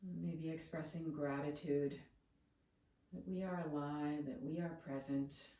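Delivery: soothing, settled meditation guide; guided meditation. Real, not acted. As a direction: ease them into stillness, long pauses between phrases.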